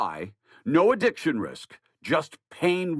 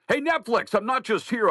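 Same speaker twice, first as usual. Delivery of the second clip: mock indignant; 7 semitones higher than usual. Talking back to Netflix.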